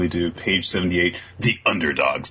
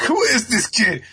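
jealous fairy. Retold or imitated. Podcaster voicing Sylph grabbing Yuno's face, high squeak. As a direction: squeak it out, suspicious. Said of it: "it's like".

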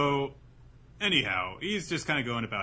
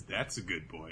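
patronizing; 7 semitones lower, 8 dB quieter than usual. Retold or imitated. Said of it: "then says".

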